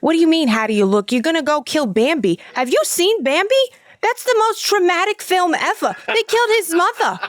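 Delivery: outraged Brooklyn mom, climbing high then dropping; Fran Drescher. Retold or imitated. Mom protesting the deer hunt, mock-horrified Brooklyn accent. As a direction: lament in a hammed-up Brooklyn accent. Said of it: "talks like Fran Dresser".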